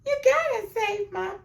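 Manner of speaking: high, whiny mock sob; mock crying plea. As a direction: parody a tearful mother begging. Mocking the crying mother.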